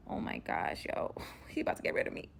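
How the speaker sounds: hushed, unvoiced dread; alarmed whisper. Captive fearing captor will kill her, alarmed whisper. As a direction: whisper it to yourself in mock panic. Fearful private thought about captor.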